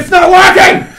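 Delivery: yelling in frustration; loud exasperated cry; frustrated shout. Real, not acted. Car won't move in game.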